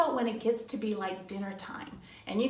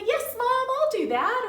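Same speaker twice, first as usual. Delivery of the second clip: high, eager agreement; chirpy, obliging yes; good attitude; 12 semitones higher, 5 dB louder than usual. Acted. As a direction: act out a cheerfully willing kid. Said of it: "when you have a good attitude".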